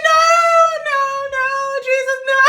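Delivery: high, loud, laughing denial; new mom laughing off the news; laughing protest. Retold or imitated. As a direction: refuse it through laughter. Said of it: "I said".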